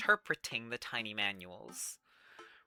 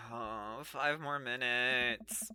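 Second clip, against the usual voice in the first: sleepy plea; 3 semitones lower, 5 dB quieter, much slower than usual. Acted. Sleeper begging to stay in bed.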